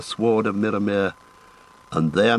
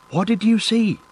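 much higher, curious hobbit voice; young hobbit Pippin; 11 semitones higher than usual. Acted. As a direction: ask brightly like a young hobbit. Young hobbit Pippin asks Sam.

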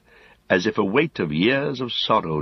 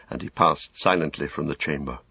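usual speaking voice

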